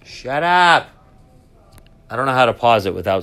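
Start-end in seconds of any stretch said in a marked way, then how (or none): 0.0-0.9 snapped loud and high at the speaker; annoyed shout. Real, not acted. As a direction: tell the announcement to shut up. Yelling at the announcement again.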